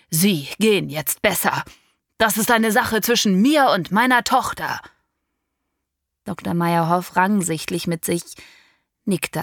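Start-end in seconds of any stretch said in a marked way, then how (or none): 0.1-4.8 louder, rising into anger; curt dismissal. Acted. Father sending the doctor out of the flat, angry. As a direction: order him out coldly then hotly.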